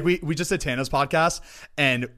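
ordinary talk, nothing marked

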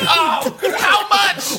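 high, loud alarmed shout. Acted. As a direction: yell it in disbelief. Shouting in chaos.

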